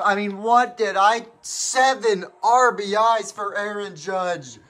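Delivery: high, ecstatic broadcaster yelling the walk-off, often slowed and drawn out; breathless home run call with laughs breaking through; excited play-by-play call. Acted. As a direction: call the game-winning grand slam like a stunned TV announcer.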